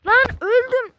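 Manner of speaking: loud yelp of defeat; frustrated shout. Real, not acted. Dies in game, frustrated.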